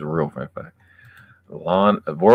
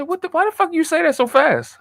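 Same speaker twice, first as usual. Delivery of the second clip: incredulous; 4 dB louder than usual. Retold or imitated. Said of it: "I said".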